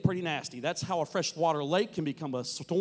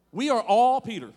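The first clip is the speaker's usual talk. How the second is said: louder, higher declaration; emphatic refrain; 5 semitones higher, 7 dB louder than usual. Acted. Preacher to congregation, proclaiming the refrain loudly. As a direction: proclaim it to the room. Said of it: "emphatic refrain".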